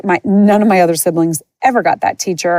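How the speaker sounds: usual speaking voice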